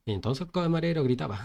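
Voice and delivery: usual speaking voice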